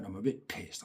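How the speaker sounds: a muttered aside to yourself; quiet aside. Real, not acted. To the listener about his dad, soft muttered aside. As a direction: drop it low and soft. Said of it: "I'm a bit pissed off with him".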